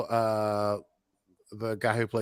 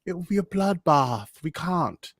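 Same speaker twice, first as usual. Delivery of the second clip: high, loud mock British protest; imitated British accent; 7 semitones higher, 3 dB louder than usual. Host retries Petra's line, louder put-on British accent. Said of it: "the British accent that Petra has".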